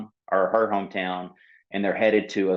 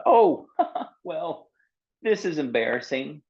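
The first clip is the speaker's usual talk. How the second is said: embarrassed laugh; 8 semitones higher than usual. Acted. Seal's stomach growls, embarrassed.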